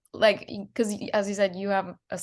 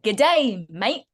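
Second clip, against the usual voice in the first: imitating a broad Australian greeting, louder first word; overdone Australian accent; 4 dB louder than usual. Actor retelling a friend's attempt at an Australian accent, overdone. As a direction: overplay the Australian accent slightly. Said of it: "It was just a bit too much".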